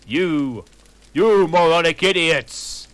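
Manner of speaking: a hammed-up furious yell; angsty shout. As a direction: bellow it like a furious villain. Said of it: "he shouted angstily".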